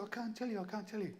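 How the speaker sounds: high-pitched mock-tearful pleading; imitated fake crying. Role-play partner refuses to explain to husband, pretend crying. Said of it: "pretending to cry".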